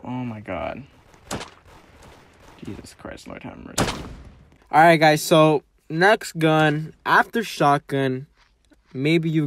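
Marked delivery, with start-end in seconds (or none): 2.6-4.0 whispered in disbelief; exasperated whisper. Real, not acted. Gamer whispering in disbelief at the chaos. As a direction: breathe it out, exasperated.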